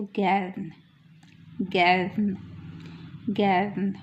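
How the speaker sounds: गैरन with the r nearly gone; German er as a. Shown as a demonstration. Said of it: "उसकी sound अ होती है".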